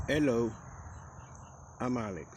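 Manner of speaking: usual speaking voice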